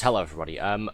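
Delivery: ordinary delivery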